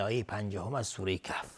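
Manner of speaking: ordinary talk, nothing marked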